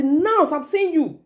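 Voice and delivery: high, drawn-out acted scolding; mock shout at child. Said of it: "shout at the child".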